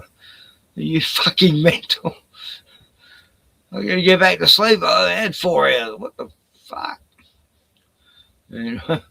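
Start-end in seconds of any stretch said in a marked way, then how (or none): 0.0-7.0 exasperated rant. Retold or imitated. Venting at early caller.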